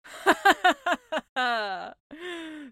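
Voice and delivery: loud cackling snicker; witch snicker. Acted. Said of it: "The sea witch snickered to herself".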